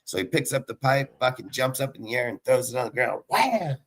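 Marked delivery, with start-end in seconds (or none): 3.3-3.8 high, falling wham; punchy sound-effect wham; imitated impact noise. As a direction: voice the crash as it hits ground. Imitating the third throw.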